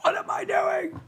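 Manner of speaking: high screaming rant; Sam Kinison scream. Retold or imitated. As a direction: scream it like a ranting comic. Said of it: "you know, Sam Kinison".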